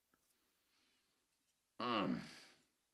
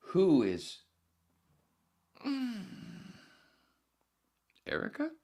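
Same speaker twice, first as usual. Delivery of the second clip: mock baffled; 9 dB louder, much slower than usual. Callback to opening bit.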